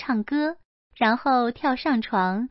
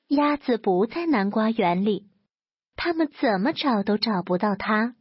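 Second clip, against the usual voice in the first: usual speaking voice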